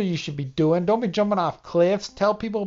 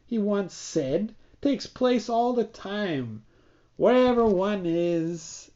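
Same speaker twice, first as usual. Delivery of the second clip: reading aloud; 4 semitones higher than usual. Acted. Reads a book passage aloud.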